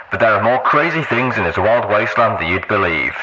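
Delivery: loud, brash radio host voice; booming DJ telling a spooky story. Acted. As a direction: spin a ghost tale for the listeners, big and showy. DJ continues ghost story.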